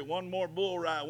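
usual speaking voice

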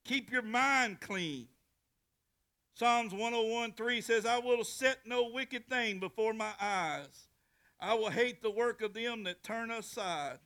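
loud, slow pulpit preaching. Acted. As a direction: proclaim the verses to the congregation. Preaching scripture at full force.

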